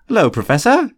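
bright, high-pitched cheerful greeting. A narrator's reading. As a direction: greet the professor with cheery innocence. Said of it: "Fred said cheerfully".

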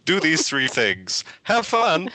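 lightly voiced magical note; mock scroll message. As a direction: read the scroll like a cheery decree.

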